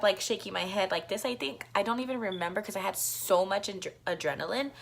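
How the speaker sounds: ordinary delivery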